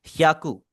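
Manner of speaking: ह्याकू as two beats, hya-ku; correct pronunciation. Shown as a demonstration. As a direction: glide straight from h into "ya" in ह्याकू. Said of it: "आप इसे pronounce करेंगे". (ह्याकू का सही उच्चारण, जैसा लिखा है)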